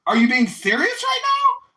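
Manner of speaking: high, loud mock manager; imitating the manager. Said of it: "and he goes".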